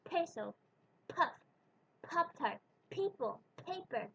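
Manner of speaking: peso, puff, Pop-Tart, people, paper all with a puff on the p; English p demo; aspirated English p. Shown as a demonstration. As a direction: blow air on each p. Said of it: "we let out a puff of air".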